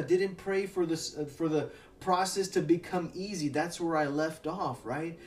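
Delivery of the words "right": ordinary talk, nothing marked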